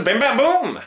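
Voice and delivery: high, punchy bang noises; gunshot sounds. A narrator's reading. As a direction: fire off the shots like a kid playing guns. Gunfire sound effects in story.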